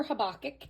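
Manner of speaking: Habakkuk as huh-BOCK-ick, stress in the middle; alternative pronunciation. Shown as a demonstration. Vlogger offering another way to say the book, middle-syllable stress. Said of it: "however you want to say it".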